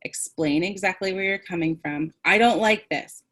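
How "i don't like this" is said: firm and a little louder; clear, confident, grounded. Shown as a demonstration. Presenter modelling conflict response, clear and confident. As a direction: state it plainly and confidently. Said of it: "be clear, confident, and grounded".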